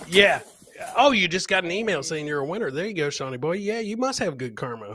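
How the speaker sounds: ordinary talk, nothing marked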